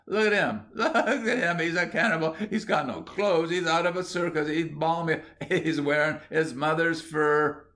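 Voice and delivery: high, laughing jeers; mock him in a sustained high, gleeful pitch; derisive cries. A narrator's reading. Small boys chase William through the field, derisive. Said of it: "uttering derisive cries".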